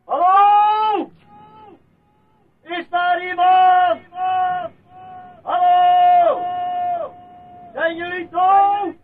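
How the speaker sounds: long, high shouted calls into emptiness; slow, drawn-out shouts to nobody; calling out. Acted. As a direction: yell it across the empty village. Calling into empty village.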